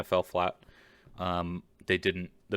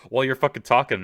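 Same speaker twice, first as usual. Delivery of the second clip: mock heavy breathing; 5 semitones higher, 8 dB louder, faster than usual. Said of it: "sounds like I'm a big fat ass".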